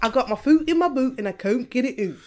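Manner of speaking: quick, comic Scottish accent; bad Scottish accent. Shown as a demonstration. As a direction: rattle it off as mock Scots. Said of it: "But that's Scottish accent".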